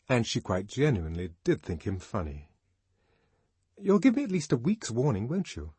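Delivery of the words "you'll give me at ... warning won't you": higher, businesslike chat; light, high-pitched checking; change of tone. A narrator's reading. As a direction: switch to practical arrangements. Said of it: "she went on in another tone".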